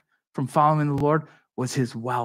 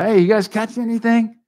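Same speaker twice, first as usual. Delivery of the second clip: higher and louder call across the water; 8 semitones higher, 6 dB louder than usual. Retold or imitated. Jesus calling from shore to the fishermen, shouting out. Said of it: "Jesus yells out".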